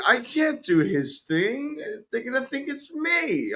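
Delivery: high, slow, whiny mock panic. Streamer retelling fear of running the stream, high mock panic. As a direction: squeak it out, a touch panicked.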